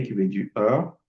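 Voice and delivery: ordinary talk, nothing marked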